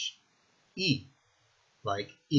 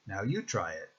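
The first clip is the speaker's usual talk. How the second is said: ordinary talk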